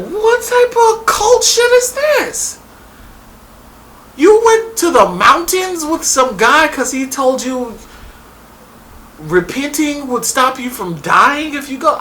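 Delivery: high-pitched what-is-this outrage; incredulous. Retold or imitated. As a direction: stretch out the disbelief. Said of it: "I'm like".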